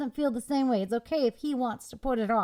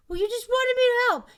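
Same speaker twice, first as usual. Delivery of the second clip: high, loud defensive protest; mock husband protesting; 13 semitones higher, 7 dB louder, faster than usual. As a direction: throw it back at her, indignant and louder. Acted couple quarrel over dishes.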